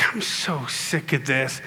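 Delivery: low, slow, quiet exasperation; subdued weary complaint; fed up. Retold or imitated. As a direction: drag it out softly, at the end of your patience. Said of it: "I finally just got fed up".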